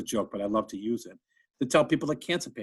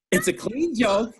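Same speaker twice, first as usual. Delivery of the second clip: ordinary delivery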